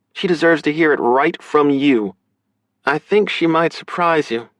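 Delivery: high, firm sister character voice; high-pitched, emphatic female voice; firm big sister. Acted. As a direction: refuse him, then reason with him, high-voiced.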